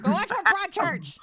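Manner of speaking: high, loud repeated order; shouted nag. Acted. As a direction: yell it back at her, teasing. Friend repeats the nagging.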